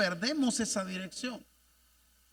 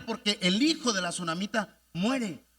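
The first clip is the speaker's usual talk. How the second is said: usual speaking voice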